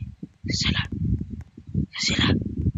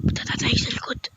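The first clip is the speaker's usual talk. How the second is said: whisper; 5 semitones lower than usual. Acted. Sneaking to the back.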